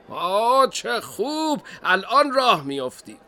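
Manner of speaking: high, loud, delighted dad; father calling back. Acted. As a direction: call back to your child with pleased surprise. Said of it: "پدر از سوی اتاق گفت". (پدر خوشحال با صدای بلند و بالا)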